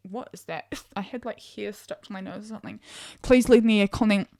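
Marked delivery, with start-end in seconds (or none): 0.1-2.8 much softer side remark; muttered aside. Real, not acted. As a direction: mutter it under your breath. Aside about hair on nose.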